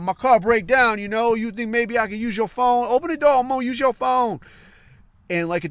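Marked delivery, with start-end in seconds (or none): 0.0-4.4 higher, louder put-on African accent; a pushy stranger in an African accent begging at the door; imitated African accent pleading to be let in; mock African accent. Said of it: "he talking about".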